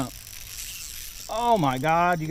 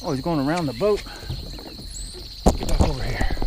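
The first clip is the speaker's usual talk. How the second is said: ordinary delivery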